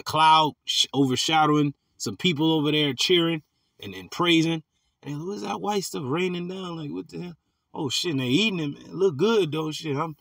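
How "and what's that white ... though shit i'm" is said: mock amazement. Preacher mimicking onlookers watching food fall from heaven, mock amazement. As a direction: play a baffled bystander watching in envy.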